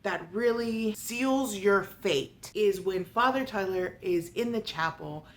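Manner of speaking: ordinary talk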